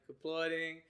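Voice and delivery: ordinary delivery, nothing marked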